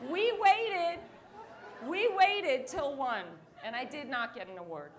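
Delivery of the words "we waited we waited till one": loud, drawn-out complaint; slow, loud, punch we waited; fed-up loud retelling; exasperated. Real, not acted.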